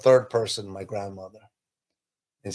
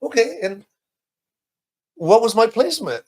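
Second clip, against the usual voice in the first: curious question; 8 semitones higher, 5 dB louder than usual. Retold or imitated. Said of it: "I was like".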